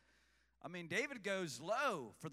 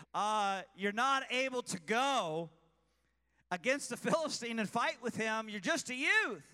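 louder, higher, mildly scornful; a hint of dismissive king; 6 semitones higher, 7 dB louder than usual. King Saul brushing off young David, mildly scornful. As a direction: wave off the boy king-style.

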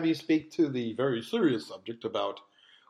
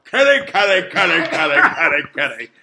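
louder coaxing kitty call; kitty cat call; 7 dB louder than usual. Shown as a demonstration. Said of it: "when I go".

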